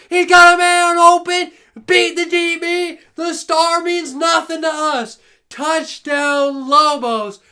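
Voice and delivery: loud, high rising call; thrilled broadcaster surge; excited call. Real, not acted. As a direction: sell the big play. Exciting play called live.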